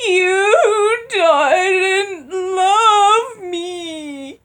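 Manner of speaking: high, slow, sung-out wail. Acted. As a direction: drag the words out in a sobbing lament. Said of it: "drowned out by Mum Olga's wails".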